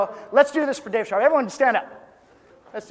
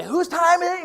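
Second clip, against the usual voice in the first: hype call; 10 semitones higher, 4 dB louder than usual. Shown as a demonstration. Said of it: "I'm gonna say".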